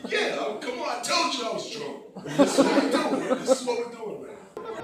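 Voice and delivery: high, loud laughing protest; real giddy laughter. Real, not acted. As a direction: laugh through the excuse. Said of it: "Scary creep".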